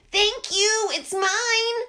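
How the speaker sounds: a proud duck, high and pleased. Acted. Duck voiced by narrator.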